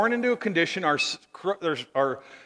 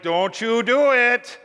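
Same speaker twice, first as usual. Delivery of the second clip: slow, higher-pitched mock scolding; warning grandma; 5 semitones higher, 5 dB louder, slower than usual. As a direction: warn slowly like a stern grandma. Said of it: "And Grandma's like".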